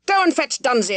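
barked order. A narrator's reading. Squire ordering his son to fetch Dunsey, angry command. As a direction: snap the command at him.

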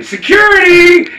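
high, drawn-out shout; mock alarmed holler; calling security. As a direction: yell for the guards, stretching the word. Retold woman calling for security.

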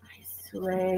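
breathy aside, barely voiced; hushed "I swear"; whispered agreement. Real, not acted. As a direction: whisper it like a secret.

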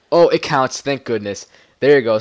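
loud happy oh; relieved cheer. Real, not acted. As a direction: burst out with relief. Relief when it works.

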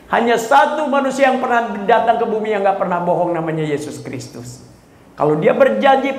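full-force preaching. Acted. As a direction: preach it to the whole hall.

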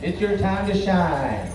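arena announcer calling dancers out; powwow emcee. Real, not acted. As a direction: call the dancers onto the floor. Emcee calls dancers to floor.

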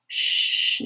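voiceless, gentle shushing; soothing hush. A narrator's reading. Kira rocks the tyke, soothing hush. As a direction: shush to settle a child. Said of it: "the tyke was soothed".

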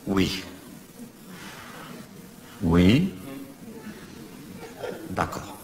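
murmure bas dans l'oreillette; réponds discrètement à la régie; répond tout bas. Acted. (répond doucement à l'oreillette)

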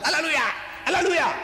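high-pitched imitation of shouting worshippers; ecstatic shout. Imitating Christians in ecstasy, shouting. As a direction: cry it out like a believer in ecstasy. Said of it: "they shout".

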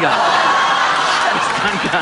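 slow, laughing through the words. Real, not acted. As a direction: wheeze it out laughing.

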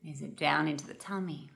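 calm, unhurried meditation guide with long pauses; guided meditation. Real, not acted. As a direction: gently guide listeners into noticing their breath.

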